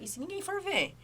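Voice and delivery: anxious inner voice. Retold or imitated. Anxious mother imagining no one checking, worried inner voice. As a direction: voice the fear in a thin inner voice. Anxious thought, higher, different timbre.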